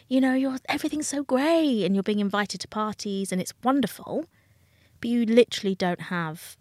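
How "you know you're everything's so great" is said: higher, gushing mock admiration; imitating fans gushing over her life. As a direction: play the starry-eyed passer-by, lightly. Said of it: "everyone going".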